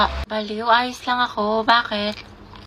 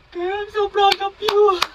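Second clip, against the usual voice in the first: gushing; 9 semitones higher, slower than usual. Acted. Gushing over friend's speech.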